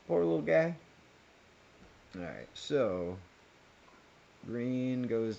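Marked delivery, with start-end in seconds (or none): 0.1-0.7 higher, louder 'Poor little guy' with 'guy' stressed; sympathetic aww. Real, not acted. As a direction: coo it out in pity, with 'guy' leaned on.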